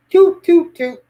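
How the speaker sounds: sharp mock horn toots; mic check toots. Real, not acted. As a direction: toot into the mic to test it. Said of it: "mic check".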